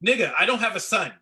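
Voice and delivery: incredulous retort. Real, not acted. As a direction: push back hard, exasperated. Exasperated retort to advice.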